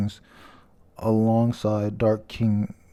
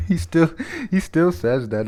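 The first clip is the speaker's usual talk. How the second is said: laughing; 6 semitones higher, 3 dB louder than usual. Real, not acted. Amused by character's line.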